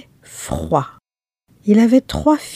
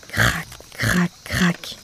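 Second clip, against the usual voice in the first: soft creaking; 3 semitones lower, 10 dB quieter, much slower than usual. Acted. Le pont de bois craquait.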